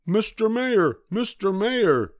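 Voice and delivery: high, urgent call. A narrator's reading. Horton calling the mayor on the speck, urgent calling. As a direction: call out to someone far below. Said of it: "Horton called".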